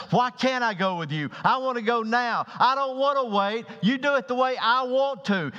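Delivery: put-on childish Peter. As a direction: whine like a stomping child. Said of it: "he seems almost childish".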